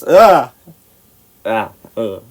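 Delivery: imitated gag of distaste, falling away; disgusted groan. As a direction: recoil from a nasty straight sip. Imitates reaction to bad drink.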